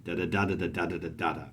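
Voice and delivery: fast, soft da-da chant; anapestic rhythm. Shown as a demonstration. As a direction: rattle off the anapests. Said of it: "that nice anapestic rhythm".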